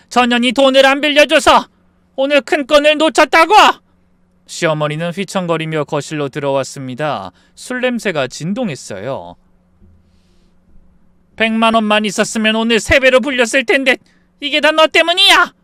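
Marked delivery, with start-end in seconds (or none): 0.1-3.5 put-on high, loud drunken tirade; furious blaming. Drunk mother-in-law blames daughter-in-law. 11.4-15.5 high, loud accusation rising to a yell; bawling at her. Acted. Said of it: "고래고래 소리를 지르는".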